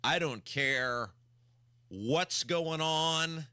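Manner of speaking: normal talking voice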